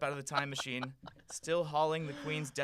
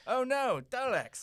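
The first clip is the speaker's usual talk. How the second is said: higher, louder hammed-up alarm; mock alarm; 12 semitones higher, 6 dB louder, slower than usual. The Doctor spotting the Daleks, in mock panic.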